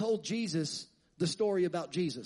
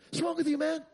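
much higher and louder, mock-incredulous; sarcastic disbelief; 9 semitones higher, 4 dB louder than usual. Disciples mocking the stranger on the road, sarcastic. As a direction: scoff at the stranger like he's clueless. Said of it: "they get a little sarcastic with Jesus".

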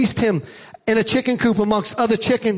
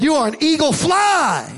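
rousing command; 4 semitones higher than usual. Acted. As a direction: voice climbing to a long, high "fly". Naturalist urging the eagle to fly.

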